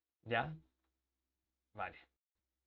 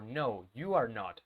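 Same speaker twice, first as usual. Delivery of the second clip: "No, you are not." slowed, falling; slow teacher model; slow drill model; 4 semitones lower, slower than usual. Shown as a demonstration. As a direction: give "No, you are not." slowly and finally. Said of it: "respuestas cortas".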